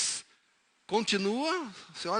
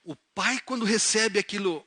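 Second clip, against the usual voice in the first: ordinary talk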